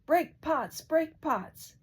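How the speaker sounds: loud, high bawled command. A narrator's reading. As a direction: bellow it like a magic spell. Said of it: "bawled out".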